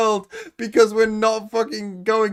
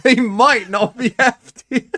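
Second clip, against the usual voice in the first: slow, louder trailer-line read with a laugh; mock tagline; 3 semitones higher, 5 dB louder than usual. Co-host reads the joke tagline for the match, slow and mock-dramatic. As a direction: pitch it like a movie tagline, slow and a bit louder. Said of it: "put that as the tagline".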